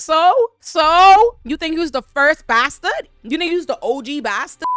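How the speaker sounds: high, loud, incredulous sass; sassy shout. Retold or imitated. Baela scolding the moping prince, loud sass. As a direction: throw it back at him loudly. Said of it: "And Baela was like".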